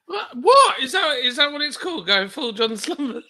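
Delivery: high, loud squeal of laughing surprise; giggling, incredulous high voice; laughing disbelief. Real, not acted. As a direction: shriek it in amused disbelief. Mocking the phrase, disbelieving laugh.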